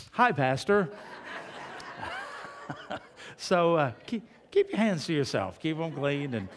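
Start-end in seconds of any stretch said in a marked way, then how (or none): 0.1-0.9 cheery greeting. Retold or imitated. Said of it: "they'll go". 1.0-3.0 a short, breathy burst of laughter at his own joke; laughing. Real, not acted.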